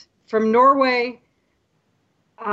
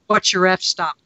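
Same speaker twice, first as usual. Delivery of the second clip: usual speaking voice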